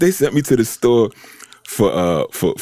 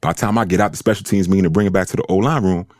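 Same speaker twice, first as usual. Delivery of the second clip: ordinary talk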